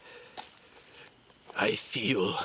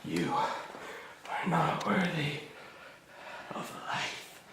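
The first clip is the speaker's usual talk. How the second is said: dark lord; 5 semitones higher than usual. Acted. Villain commands kneeling.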